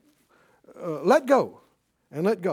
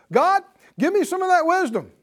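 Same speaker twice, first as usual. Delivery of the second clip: bold asking; 10 semitones higher, 3 dB louder than usual. Acted. Voices a bold prayer.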